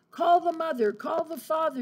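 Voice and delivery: fed-up principal shouting orders; principal yelling. Acted. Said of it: "yelled the principal".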